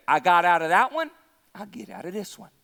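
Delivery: lightly voiced, low and casual boast; self-assured Samson. Preacher voices Samson sure he will escape, low and self-assured.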